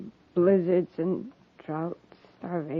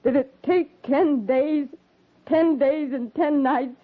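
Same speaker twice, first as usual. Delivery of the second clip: anguished outburst; 8 semitones higher than usual. Acted. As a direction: voice rising high with grief and anger. Widow confronts captain over husband's death.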